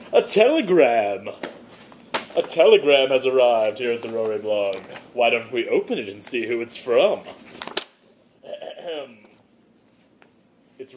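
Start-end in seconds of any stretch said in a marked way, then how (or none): 0.1-4.8 mock announcement.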